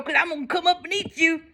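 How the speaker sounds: high, fast threat; troll's roar. Acted. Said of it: "roared the troll".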